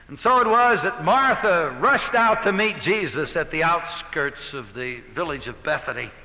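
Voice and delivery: ordinary talk, nothing marked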